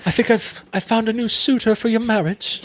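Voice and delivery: high-pitched mock British accent; British period-drama accent. Film character announcing a suitor, high mock British accent. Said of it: "when I'm doing the impressions".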